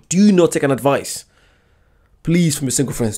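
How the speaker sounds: ordinary talk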